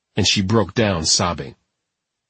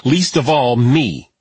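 higher, louder angry retort; 4 semitones higher, 4 dB louder, slower than usual. Acted. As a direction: snap back in anger. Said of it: "getting to her feet angrily".

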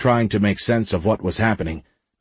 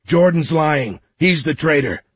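higher, urgent accusation; raised, insistent voice; shouting; 7 semitones higher, 3 dB louder than usual. A narrator's reading. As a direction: call it out to convince a crowd. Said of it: "Michael shouted".

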